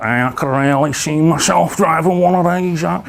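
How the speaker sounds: slow, put-on Manchester drawl; Manchester accent. Lad sitting in a luxury car he likes, Manchester accent. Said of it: "they were from Manchester".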